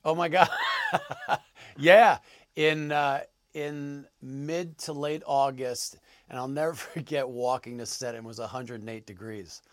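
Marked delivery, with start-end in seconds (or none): none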